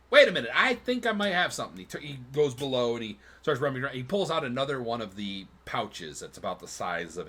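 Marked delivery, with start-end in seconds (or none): 0.1-1.8 fast, high, loud sudden idea; excited tanner. Acted. Tanner suddenly remembering a pouch, fast and high. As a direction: blurt out the idea quickly and high. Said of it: "and he goes".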